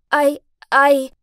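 loud, halting attempt to speak; shaken stammer. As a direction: struggle to get a word out from fright. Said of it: "could barely speak as I was shaking".

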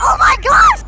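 high, loud gasp of disbelief; real shock, shouted high; shocked shriek. Real, not acted. Monster bouncing on teammate.

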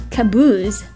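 normal talking voice

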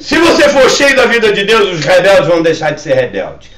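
strong declaration falling in pitch at the end; forceful preaching. Real, not acted. Pastor promising change to those full of God's life, forceful preaching.

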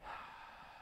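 soft exasperated sigh; a weary, disgusted breath. Retold or imitated. Preacher voicing a fed-up Jesus, sighing. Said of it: "the look of Jesus was like".

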